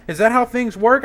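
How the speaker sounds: high, loud, rising disbelief; incredulous question. Real, not acted. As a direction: ask it like it's outrageous. Ranting at a boss who won't pay.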